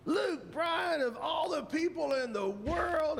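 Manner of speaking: high-pitched mock outrage; hammed-up, high incredulous holler. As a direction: imitate an exasperated singer calling someone out. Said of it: "and he goes".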